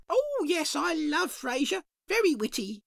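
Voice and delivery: high, sing-song dearie voice; a chirpy shopkeeper greeting at the bell. Acted. Shopkeeper greets a customer, high and sing-song.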